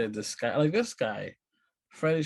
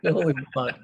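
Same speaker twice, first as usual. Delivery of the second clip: mock shocked surprise; 4 semitones higher, 4 dB louder than usual.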